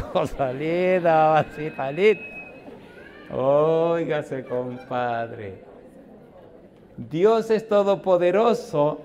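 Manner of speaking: loud, slow, drawn-out exclamations; booming joyful praise; fervent preaching. Real, not acted. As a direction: lift the congregation with joy. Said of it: "preaching at full force".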